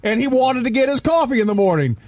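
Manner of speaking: high mock retort. Imagined retort to coworker.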